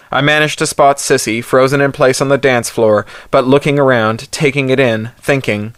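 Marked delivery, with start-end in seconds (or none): none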